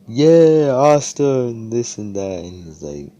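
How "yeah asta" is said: excited cheering. Acted. Villagers cheering the hero.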